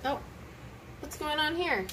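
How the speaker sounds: usual speaking voice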